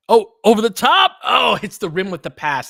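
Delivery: high, loud burst of dismay; excited groan. Real, not acted. Fan reacting to a missed lob, excited dismay. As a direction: yelp as the pass sails over.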